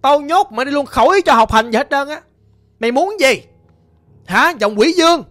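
loud, high scolding mother; angry scolding. Retold or imitated. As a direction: threaten her like a furious mother. Mother threatens to lock daughter.

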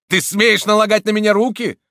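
high, loud outraged protest; how dare you, shouted; indignant shout. A narrator's reading. Priest seized by the collar, indignant shout.

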